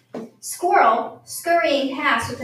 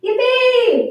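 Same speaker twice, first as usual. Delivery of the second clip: much higher, louder whoop; braying yippee; 10 semitones higher, 7 dB louder than usual. A narrator's reading. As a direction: bray it out with joy. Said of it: "brayed Goat".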